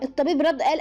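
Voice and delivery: usual speaking voice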